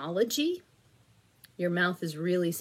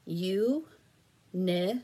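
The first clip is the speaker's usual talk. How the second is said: each syllable said apart, slowly; split into syllables. Shown as a demonstration. Teacher sounds out syllables for a blending drill, slowly. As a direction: sound out the word beat by beat. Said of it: "I'm going to give you some syllables".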